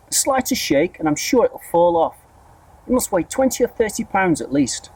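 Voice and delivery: high, pinched and bossy; high mean aunt. A narrator's reading. Aunt scolds the boy near the tree, high and bossy. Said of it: "Aunt Spiker yelled".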